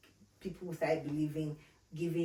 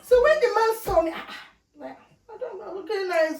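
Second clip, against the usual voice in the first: high, loud, giggly brag; laughing boast; 13 semitones higher, 11 dB louder than usual. Retold or imitated. Boasting to friends she looked nice, laughing. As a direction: crow about looking good. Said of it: "boasting about her look".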